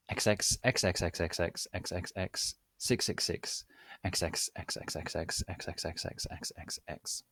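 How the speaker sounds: X's trailing off to a soft whisper; fading whisper. Acted. Teen author signing off with kisses, fading whisper. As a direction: let the X's die away into a hiss.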